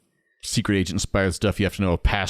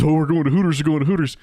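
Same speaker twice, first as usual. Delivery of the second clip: higher, louder mock teen excitement; hammed-up hormonal boy eager to go; excited teenage boy; 8 semitones higher, 4 dB louder, faster than usual. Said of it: "teenage boy hormones".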